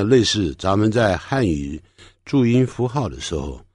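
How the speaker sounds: ordinary talk, nothing marked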